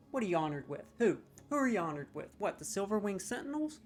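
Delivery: normal talking voice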